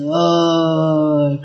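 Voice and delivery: long drawn-out mock-tearful "oh"; mock crying. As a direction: imitate a weepy moviegoer.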